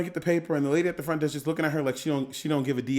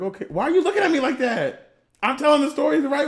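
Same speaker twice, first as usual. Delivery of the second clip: higher, louder, mock-offended; mocking the cohost; 9 semitones higher, 5 dB louder than usual. Teasing his cohost by imitating her, mock-indignant. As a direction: poke fun at her by overplaying her indignation. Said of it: "and she's like".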